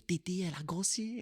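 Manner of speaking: high, soft Sino-Cambodian mum impression; mother's Sino-Cambodian accent. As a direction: imitate your mother's blunt remark in a Sino-Cambodian accent. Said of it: "avec l'accent, c'est bonus".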